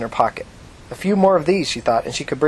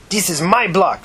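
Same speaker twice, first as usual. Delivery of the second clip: growling; 6 semitones higher, slower than usual. Acted. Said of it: "she growled".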